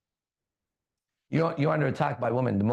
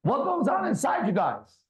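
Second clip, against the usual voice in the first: shouted question; 9 semitones higher than usual. Real, not acted. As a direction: yell it out to everyone. Calling out to the room.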